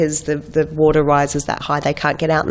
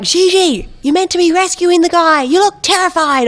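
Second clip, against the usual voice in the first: much higher, louder teasing crew voice; mock crew voice; 13 semitones higher, 3 dB louder than usual. Crew telling Gigi she looks terrified, high mock call. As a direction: tease her like the crew did. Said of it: "And they're like".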